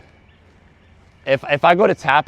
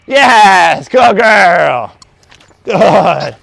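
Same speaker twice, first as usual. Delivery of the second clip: high, loud, gushing dog praise; animated praise; 5 semitones higher, 9 dB louder, much slower than usual. Real, not acted. Trainer praising dog on the mat, high and excited. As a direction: cheer the dog on like she just won. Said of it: "You're so animated".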